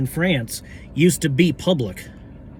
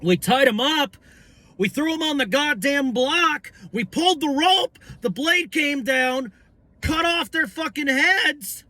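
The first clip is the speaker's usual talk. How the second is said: fan working himself up over the win; rising rant; 10 semitones higher than usual. Real, not acted. Fan gloating about beating the Giants, building to a high shout.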